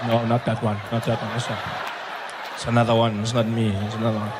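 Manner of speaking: slightly low, hurried excuses; lightly acted wriggling out of blame; sheepish denial. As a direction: back away from the accusation in mock meekness. Acted denial to the accusing nurse.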